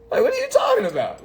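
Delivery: very high-pitched, quick disbelief; acted incredulity. Borrower disputing the contract, high incredulous protest. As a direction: squeal it in comic disbelief.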